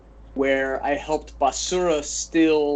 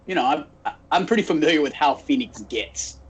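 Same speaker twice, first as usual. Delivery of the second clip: ordinary talk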